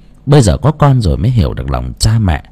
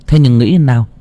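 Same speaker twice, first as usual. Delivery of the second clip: ordinary delivery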